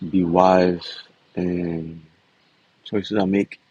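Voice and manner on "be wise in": ordinary talk, nothing marked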